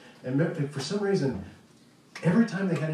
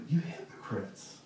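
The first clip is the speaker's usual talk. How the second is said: soft, breathy, slow and low, like a let-down father; a heartbroken father, almost in tears; brokenhearted; 4 semitones lower, 8 dB quieter, slower than usual. Shown as a demonstration. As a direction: whisper it with disappointment, not anger. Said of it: "Almost brokenhearted".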